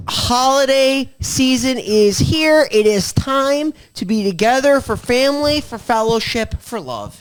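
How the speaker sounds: high, drawn-out proclamation; grand show intro. Pretend. Host proclaiming the holiday season to listeners, grand show intro. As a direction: announce the holiday like a pageant, easing down at the end.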